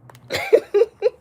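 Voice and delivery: loud, high-pitched cackling laugh; real laughing fit. Real, not acted. Streamer cracking up after the mom comeback, loud high laugh.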